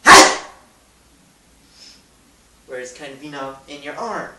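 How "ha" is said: loud sneeze into the arm; sneeze yell. Shown as a demonstration. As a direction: bark a sneeze into your sleeve. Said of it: "you gotta go".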